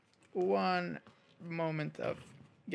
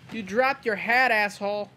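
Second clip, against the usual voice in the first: gloating taunt; 4 semitones higher, 5 dB louder than usual. Real, not acted. Taunting enemy after shooting.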